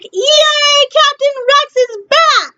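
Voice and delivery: high, slow, squealing cheer; excited cheer. Real, not acted. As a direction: celebrate like a thrilled fan. Cheering news of Rex episode.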